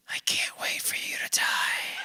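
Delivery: whispered slow spiteful line; mock old spouse. Grandparent arguing with spouse, mock whisper. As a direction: hiss it like an old wife. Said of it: "there truly is a level of".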